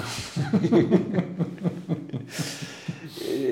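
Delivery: bright burst of laughter, pitched high; real laugh. Real, not acted. Author laughing at his own answer, a real laugh. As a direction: laugh out loud at your own joke.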